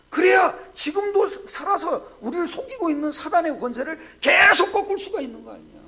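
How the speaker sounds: loud rising proclamation; preaching shout. Real, not acted. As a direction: drive the point home at full voice. (힘차게 외치는 설교)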